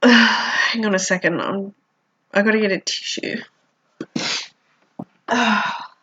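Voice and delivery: choked up, words fading; real sniffly tears. Real, not acted. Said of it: "Sorry if I'm sniffly".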